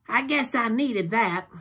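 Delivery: high, slow drowsy purr; drowsy Loretta. A narrator's reading. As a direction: stretch it out sleepily.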